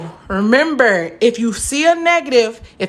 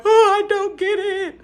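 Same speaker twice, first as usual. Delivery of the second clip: mock tearful whine, pitched up; imitating herself crying; 8 semitones higher than usual. Student retelling her math-lab frustration, a mock tearful whine. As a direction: replay her college self whining about math. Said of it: "crying in the, in the math lab".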